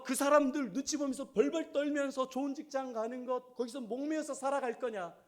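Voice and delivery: scolding that climbs high and loud; forceful rebuke. Retold or imitated. Pastor rebuking a young member about her job, forceful scolding. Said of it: "강하게".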